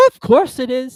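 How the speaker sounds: put-on high, loud fussy old duchess. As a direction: prattle like a silly duchess. Duchess character voice.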